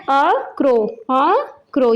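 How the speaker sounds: "a crow" as two separate, careful words; pronunciation model. Shown as a demonstration. Teacher showing children how to pronounce it, careful pronunciation model. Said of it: "ये इसकी pronunciation है".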